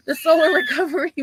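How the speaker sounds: loud, high burst of laughter through the words; laughing shout. Real, not acted. Wife laughing at co-host who mocked her, loud laughing shout. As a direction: blurt it out cracking up.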